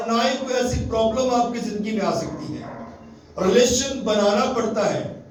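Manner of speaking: preaching at full force. Acted. Pastor urging his congregation toward God, rising preaching voice. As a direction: lift the hall, proclaim every phrase.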